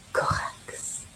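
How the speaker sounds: whispered station name. Acted. Host whispering the station name to listeners. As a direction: breathe the name out, no voice.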